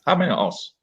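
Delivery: usual speaking voice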